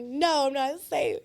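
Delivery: hammed-up victim, slow; mock distressed. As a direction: overplay the fear, slow.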